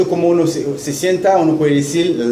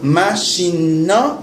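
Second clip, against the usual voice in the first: Machine na said slowly for repeating; slow, clear model for the class; slow repeat-after-me demo; much slower than usual. Shown as a demonstration. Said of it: "Repite après".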